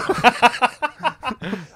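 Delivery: real high-pitched burst of laughter; laughing. Real, not acted. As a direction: crack up at your own joke. Reacting to own gronk joke.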